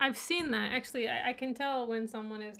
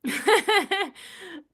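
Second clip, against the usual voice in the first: loud laugh; 8 semitones higher, 11 dB louder than usual. Real, not acted. Bursts laughing at chat comment.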